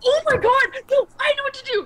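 high, loud burst of excitement; excited realization. Real, not acted. Player suddenly knowing what to do, excited. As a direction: exclaim it as the idea hits. Sudden idea during the game.

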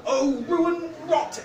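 high, loud, drawn-out groan of dismay; groaned curse. A narrator's reading. Arianwen curses as the new snotlings appear, high loud groan. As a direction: groan it out slowly, pitched right up. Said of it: "Arianwen groaned".

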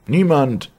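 high, loud, despairing call; high woman's voice, calling without hope; bleak answer. A narrator's reading. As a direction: call back hopelessly in a high-pitched voice. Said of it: "klang die trostlose Antwort".

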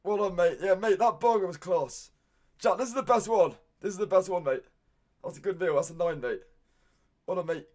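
usual speaking voice

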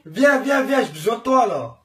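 loud, high calling across rooms; from another room. Real, not acted. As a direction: call her over from the bathroom. Calling her to the bathroom.

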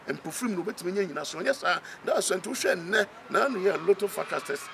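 ordinary delivery

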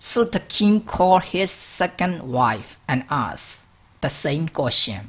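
slow, careful reading aloud for learners; slow story reading. Shown as a demonstration. Teacher reads a story line to students, slow and clear. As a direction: read the sentence slowly and clearly, word by word.

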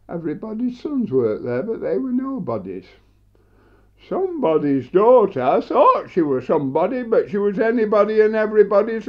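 animated, rhythmic tale-telling to an audience; comic monologue recital. Acted.

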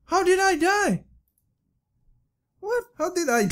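high shocked disbelief; stunned, voice jumps high. Real, not acted. Sudden death in game.

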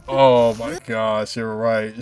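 normal talking voice

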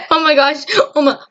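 ordinary talk

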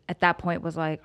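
normal talking voice

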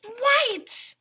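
high, bright cry; excited answer. Acted. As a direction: exclaim it like a happy reveal.

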